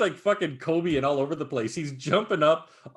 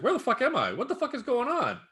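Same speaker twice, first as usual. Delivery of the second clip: high, startled monster waking up lost; hammed-up bewildered Kong voice; confused Kong; 10 semitones higher than usual. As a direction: play the dazed giant ape, pitched up. Kong wakes up, voiced by teller.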